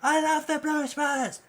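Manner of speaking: very high, loud fan shout; crowd cheering. Acted. Audience cheers the band, shouting high. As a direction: scream it like a thrilled fan. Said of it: "everyone's going".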